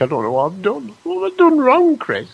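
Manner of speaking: playful wail climbing very high; mock wail. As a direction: wail it in pretend bewilderment. Mock protest after being corrected.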